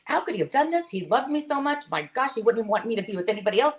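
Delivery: high-pitched, indignant retelling of her anger; mock angry disbelief. Widow retelling her anger at her husband, high indignant. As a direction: throw the hurt question up, half-exasperated. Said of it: "I'm pissed off some days".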